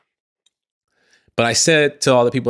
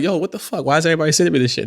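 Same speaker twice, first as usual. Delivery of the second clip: fast, higher-pitched mock outrage; irritated friend; 6 semitones higher, faster than usual. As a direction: rattle it off like an annoyed bro. Friend angrily texts back.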